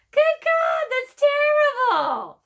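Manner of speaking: very high, loud shocked squawk; horrified raven cry. Acted. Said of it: "cried the first raven".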